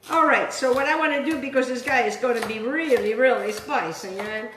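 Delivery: usual speaking voice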